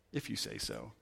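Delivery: Jesus brushing off Pilate, low and dismissive; dismissive mutter. Retold or imitated. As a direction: shrug it off under your breath. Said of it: "either one of these ways".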